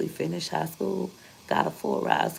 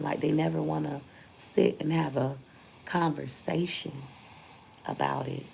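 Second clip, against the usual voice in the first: ordinary talk, nothing marked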